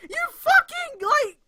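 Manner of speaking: high, loud outburst; exasperated yell. Real, not acted. Streamer exploding at the fanfic author, exasperated yell.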